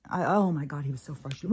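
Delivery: ordinary talk, nothing marked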